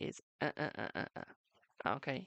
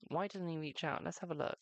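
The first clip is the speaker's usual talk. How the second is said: ordinary talk, nothing marked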